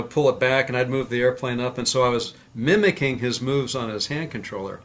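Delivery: usual speaking voice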